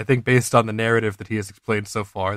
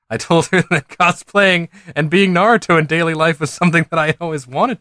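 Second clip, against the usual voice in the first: higher, amused reading through laughter; laughing while reading; 4 semitones higher, 3 dB louder than usual. Real, not acted. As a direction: read it while cracking up. Reader cracks up at the story.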